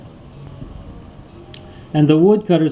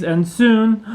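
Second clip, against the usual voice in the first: ordinary delivery, nothing marked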